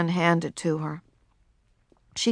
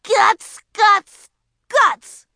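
put-on high, loud child's yell; loud high-pitched shout; 12 semitones higher, 8 dB louder, much slower than usual. A narrator's reading. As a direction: yell the bad word to shock them. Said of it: "she yelled".

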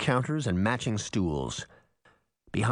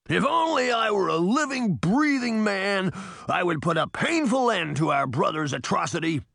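cried out; 8 semitones higher than usual. Acted. Titan vowing revenge on the evil first mirror. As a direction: declare it with blustery fury. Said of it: "Now he cried".

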